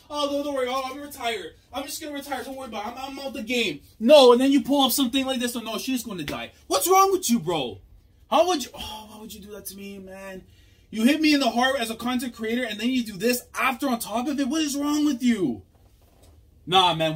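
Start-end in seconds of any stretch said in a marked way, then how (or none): 0.1-3.8 high-pitched, breezy mock reassurance; much higher mocking voice; mock quote. Mock-quotes retiring character. 4.0-15.6 much higher and louder, fast indignant rant; real frustrated outburst; outraged yelling. Real, not acted. Outraged at the plot twist.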